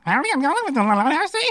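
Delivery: attempted British accent. Shown as a demonstration. Radio guest showing hosts he can do accents, botched British accent. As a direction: have a go at British and mangle it quickly. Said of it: "Oh, British".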